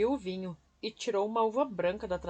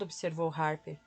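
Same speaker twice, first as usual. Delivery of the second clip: plain reading voice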